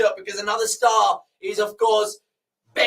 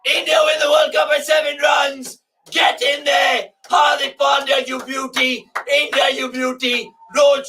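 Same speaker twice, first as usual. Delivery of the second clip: loud, higher victory commentary call; jubilant shouting; 4 semitones higher, 5 dB louder than usual. Real, not acted. Fan calling the last ball to viewers, jubilant shout. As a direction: bellow the win like a commentator.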